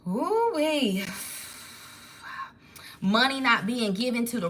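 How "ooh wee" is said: surprised ooh-wee. Real, not acted. Reacting to a card revelation.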